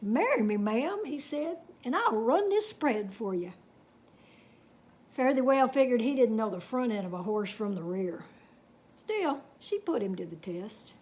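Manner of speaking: unhurried Texas tall-tale telling; story narration. A narrator's reading. Reading a cowboy tale to children, storytelling. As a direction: spin the yarn for the kids.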